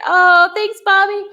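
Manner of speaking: high, loud delighted thanks; high, loud happy thanks to a viewer; excited thanks. Real, not acted. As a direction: beam out your thanks.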